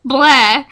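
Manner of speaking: loud bleh of disgust. Acted. Grace and Katie disgusted by Tack, loud bleh. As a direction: spit it out grossed out.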